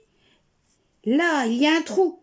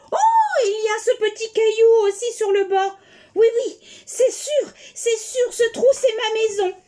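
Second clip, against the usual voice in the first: joie; 6 semitones higher, 3 dB louder than usual. Acted. La souris heureuse.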